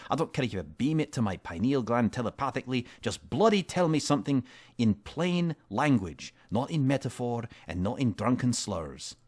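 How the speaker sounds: fed-up adventurer scolding; angry demand. Acted. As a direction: lose patience with the drunk.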